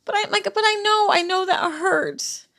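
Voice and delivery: fighting through tears, voice cracking; crying. Real, not acted. Mother tells friend how her son's photo hurts, crying hard. Mother grieving son, breaking down.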